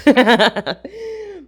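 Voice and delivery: short high laugh at herself. Real, not acted. Laughing at her own rant, a short high laugh.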